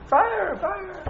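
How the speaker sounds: high-pitched mock scream, held back in volume; retold scream. Teacher re-enacts his brother's alarm for the class, mock scream. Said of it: "I remember my brother screaming".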